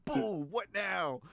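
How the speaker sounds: high, loud, drawn-out mock taunt; fake trash talk. Co-host teasing his friend by imitating his trash talk. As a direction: imitate a friend's cocky game challenge. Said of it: "it's like your fake trash talk voice that you have".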